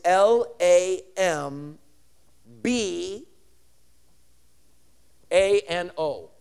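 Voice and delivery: slow, letter-by-letter spelling; each letter on its own. Shown as a demonstration. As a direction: spell it for a class, pausing between letters. Spells the Greek word out.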